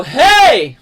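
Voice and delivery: loud, high belted call; powerful open shout; big-breath yell. Shown as a demonstration. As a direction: take a big breath and holler it. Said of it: "take a nice big breath and just go".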